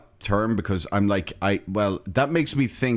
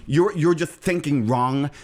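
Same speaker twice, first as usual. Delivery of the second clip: put-on drawn-out, lispy Valley Girl; 3 semitones higher, slower than usual. Host mocking a wrong thinker, slow Valley Girl voice. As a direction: stretch it out like a lisping Valley Girl. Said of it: "I'm just doing a Valley Girl voice".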